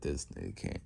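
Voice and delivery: low, very soft retold aside; muted disbelief. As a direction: say it low and quiet, as a mock reaction. Retold Aizawa reacting in disbelief.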